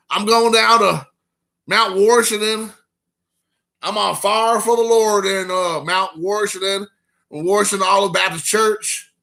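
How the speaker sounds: a hint of a churchgoing Southern Indiana local; Southern Indiana accent. Imitating a Southern Indiana churchgoer, light Southern Indiana accent. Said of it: "that's a thing in Indiana".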